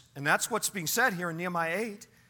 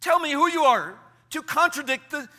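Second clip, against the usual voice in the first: high, loud, challenging rebuke; stern self-sermon; 8 semitones higher, 7 dB louder than usual. Acted. Man preaching at his own soul for contradicting God, stern rebuke. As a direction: confront your soul forcefully, pausing between challenges. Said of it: "Now you continue your sermon".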